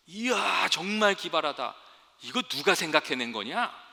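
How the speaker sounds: loud, high-pitched amazed call; acted yell of admiration; admiring shout. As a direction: shout up in wonder at a friend. Friend frog shouts admiration upward.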